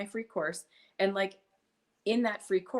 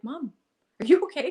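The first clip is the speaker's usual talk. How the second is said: put-on worried kids; 7 semitones higher, 9 dB louder than usual. Kids worried after mom's cartwheel fall.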